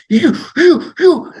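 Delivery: shocked sputter. Retold or imitated. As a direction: sputter like an official lost for words. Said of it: "It's like surprise, anger, shock".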